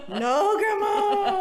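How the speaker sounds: loud, high, drawn-out mock denial; hammed-up guilty "who, me?"; exaggerated denial. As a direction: protest your innocence to Grandma, stretched out high. Tipsy teen denying it to grandma.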